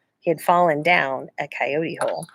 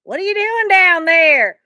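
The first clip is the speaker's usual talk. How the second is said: stranger calling down; 12 semitones higher, 5 dB louder, slower than usual. A narrator's reading. Stranger peers into hole.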